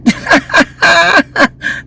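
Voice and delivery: high, hard burst of laughter; cackling laugh. Real, not acted. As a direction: break up laughing. Laughing at the overheard talk.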